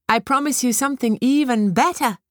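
high, bright, otherworldly fairy; a teasing fairy promise; tiny tinkling fairy voice. A narrator's reading. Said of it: "said the birch fairy".

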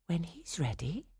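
soft, hushed whisper; whispered reply. A narrator's reading. Clara answering her impatient brother, whispered. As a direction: whisper it back gently.

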